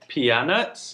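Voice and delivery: pea nuts said pee-AH-nuts; slow "pea nuts" with an added "ah" syllable; joke mispronunciation. Shown as a demonstration. Jokingly guesses a mispronounced nut.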